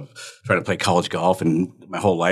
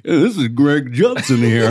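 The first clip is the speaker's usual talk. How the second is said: slow, louder mock announcer voice; radio phone voice; 3 semitones higher, 5 dB louder, slower than usual. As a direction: stretch it out like a radio host. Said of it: "is that what I sound like".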